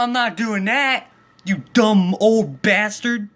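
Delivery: retold furious comeback to the old man; angry retort. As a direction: yell back in outrage at the neighbor. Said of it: "I'm like".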